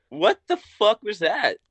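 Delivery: high-pitched retold disbelief; mock-bewildered exclamation; incredulous. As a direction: act out being stunned, voice jumping up. Acting out reaction after the insult.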